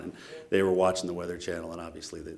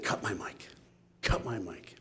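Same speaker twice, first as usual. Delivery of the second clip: quiet aside; 11 dB quieter than usual. Retold or imitated. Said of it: "essentially said".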